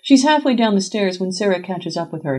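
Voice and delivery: ordinary delivery, nothing marked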